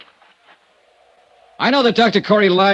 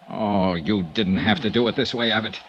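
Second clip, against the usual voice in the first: low, soft, gentle; tender comfort; 10 semitones lower, 7 dB quieter than usual. Acted. Dollar kneeling by the wounded Abbott, soft and tender. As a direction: ease a dying man.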